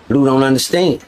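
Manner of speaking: normal talking voice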